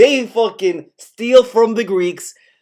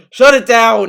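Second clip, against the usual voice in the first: loud laughing yell, higher on the repeat; mock outraged shout; 3 semitones higher, 6 dB louder than usual. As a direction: call it out loudly in mock outrage.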